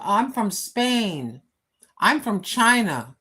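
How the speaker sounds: ordinary talk, nothing marked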